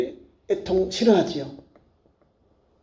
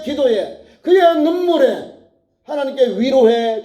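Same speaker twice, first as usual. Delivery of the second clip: high, declaiming preacher's cry; fervent preaching; 7 semitones higher than usual. Real, not acted. Preacher telling how God answered Hannah's tears, impassioned proclamation. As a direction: proclaim it with mounting urgency.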